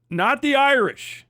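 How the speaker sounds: usual speaking voice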